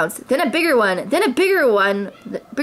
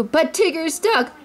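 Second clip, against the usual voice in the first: laughing; 8 semitones higher, slower than usual. A narrator's reading. Roo amused at Tigger stuck.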